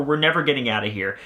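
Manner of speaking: ordinary talk, nothing marked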